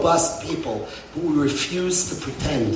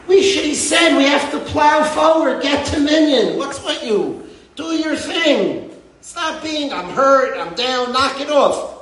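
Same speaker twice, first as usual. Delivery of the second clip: retold stern rebuke; 8 semitones higher than usual. Rabbi imitating the critic's letter, a mock scold. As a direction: mimic a pushy critic telling them to toughen up.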